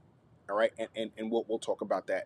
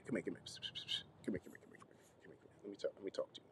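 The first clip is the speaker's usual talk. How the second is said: hushed, beckoning whisper; very soft, playful whisper of confidence; conspiratorial whisper; 3 semitones lower, 18 dB quieter, much faster than usual. Pretend. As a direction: draw them in close to share a secret. Beckons listeners closer for a secret.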